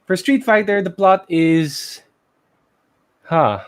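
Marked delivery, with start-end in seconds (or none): none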